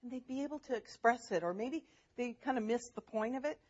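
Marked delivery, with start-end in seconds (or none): none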